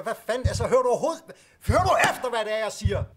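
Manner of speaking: high, loud outraged yelling; furious shout. Acted. Patient to ignoring doctor, furious outraged shout. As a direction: blow up at him.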